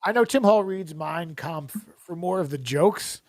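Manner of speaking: normal talking voice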